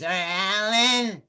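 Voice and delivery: imitating a drunk Freudian therapist; drunken therapist. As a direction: drag the words out like a tipsy shrink. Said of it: "she goes".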